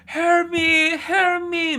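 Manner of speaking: high, loud mock-desperate yell; staged cry for help. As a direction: cry out for rescue, hammed up and drawn out. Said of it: "살려달라고 막 소리치는 거야". (살려달라고 과장되게 외치는 높은 목소리)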